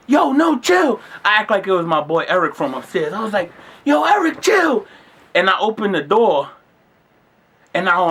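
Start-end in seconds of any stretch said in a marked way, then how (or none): 0.1-1.0 high-pitched playful protest; mock scuffle protest. Pretending to wrestle a friend under a sheet, mock protest. 3.8-4.9 high-pitched playful protest; mock scuffle protest. Pretending to wrestle a friend under a sheet, mock protest.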